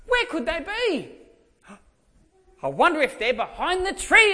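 high, loud mock-wondering voice; hammed-up searching. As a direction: pretend to hunt for hiding kids. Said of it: "I'll play along with them".

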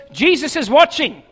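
a voice in the dark catching the burglar; disembodied voice, clear as a bell. A narrator's reading. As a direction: call it out from nowhere. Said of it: "clear as a bell he hears these words".

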